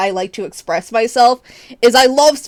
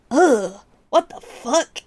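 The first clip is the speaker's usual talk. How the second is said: mock disgusted reaction; slower than usual. Streamer reacting to hearing themself in videos, mock disgust. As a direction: recoil at your own recorded voice. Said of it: "I just gotta go".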